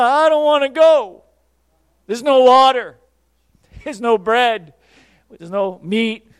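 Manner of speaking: loud grumbling Israelites, fading off; whining complaint. Retold or imitated. Israelites in the wilderness grumbling to Moses, loud whine. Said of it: "And they complained".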